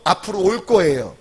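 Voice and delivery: ordinary talk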